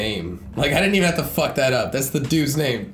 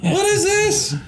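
amazed; 4 dB louder, slower than usual. Real, not acted. Excited surprise at screen.

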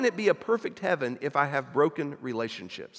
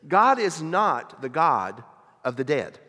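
ordinary delivery

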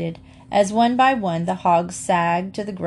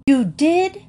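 higher, louder startled question; surprised exclaim; 9 semitones higher, 5 dB louder than usual. A narrator's reading. Mamma reacts to Emily's confession, startled and louder. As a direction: catch her breath in disbelief. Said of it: "exclaimed Mamma".

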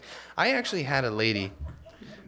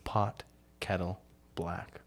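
mock-sultry saying; 4 semitones lower, 5 dB quieter, slower than usual. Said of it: "not a sexier saying".

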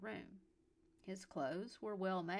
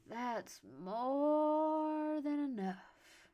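high, loud, hugely drawn-out "more"; exuberant drunk; 6 semitones higher, 8 dB louder, much slower than usual. A narrator's reading. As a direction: crow it tipsily, very slowly. Drunk Calder calls out, delighted.

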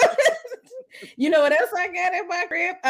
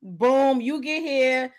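ordinary delivery

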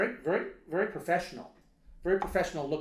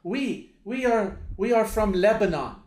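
put-on loud, high, Lebanese-accented restarts; halting Lebanese accent; 7 semitones higher, 10 dB louder than usual. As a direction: imitate a Lebanese man building up to his words, emphatic. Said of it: "he goes, uh".

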